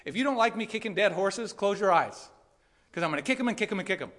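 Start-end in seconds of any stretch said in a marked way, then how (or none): 2.9-4.1 fast joking rush. Acted. Joking rapid repetition.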